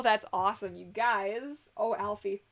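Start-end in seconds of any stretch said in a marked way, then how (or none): none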